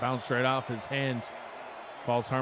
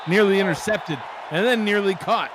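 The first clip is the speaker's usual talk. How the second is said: sudden loud burst, raised pitch; excited play call; 5 semitones higher, 6 dB louder, faster than usual. Real, not acted. Announcer cutting off banter to call a pass, excited. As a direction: jump in loudly on the near miss. Live call of near interception.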